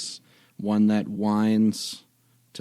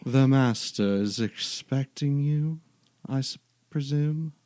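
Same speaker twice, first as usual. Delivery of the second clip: creepy butler; 4 semitones higher, slower than usual. Acted. GM voices the spectral butler.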